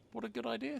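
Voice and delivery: high, eager exclamation; young dragon thrilled by the offer; delighted. A narrator's reading. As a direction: pop up with glee. Zog delighted by idea.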